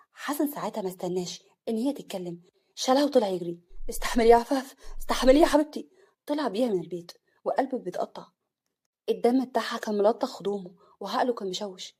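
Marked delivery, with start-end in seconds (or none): 3.9-5.9 loud, desperate urging; frantic pleading. Acted. Husband carrying bleeding wife, frantic and loud. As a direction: beg her to hold on, panicked.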